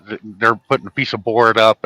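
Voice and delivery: normal talking voice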